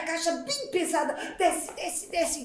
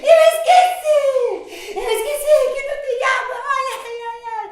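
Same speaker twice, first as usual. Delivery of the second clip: much higher, loud laughing shriek; comic panic; 10 semitones higher, 5 dB louder than usual. Acted. Soapy mother remembering there is no water, high comic panic.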